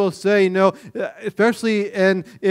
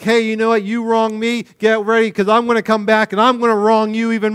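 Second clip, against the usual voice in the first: louder, higher taunting threat; mock threatening; 6 semitones higher, 5 dB louder, faster than usual. Pastor mimicking tit-for-tat revenge, mock threat. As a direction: throw it out like a petty grudge. Voicing a vengeful person.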